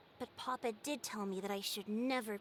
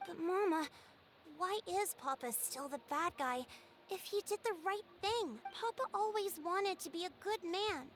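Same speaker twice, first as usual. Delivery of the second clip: put-on higher, insistent child protest; upset pleading; 7 semitones higher than usual. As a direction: plead for Papa, rising higher. Daughter protests father called bad.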